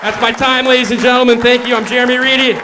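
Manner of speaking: high, loud closing announcement; loud sign-off. Real, not acted. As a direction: shout over the applause, wrapping up. Comic signs off over applause.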